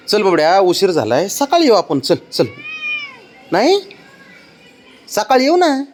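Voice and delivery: high, sing-song coaxing. Real, not acted. Coaxing child to leave.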